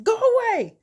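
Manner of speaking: very high, loud scream; furious yell. Retold or imitated. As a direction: yell it while punching a door. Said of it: "punched the door and said".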